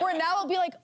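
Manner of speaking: ordinary talk, nothing marked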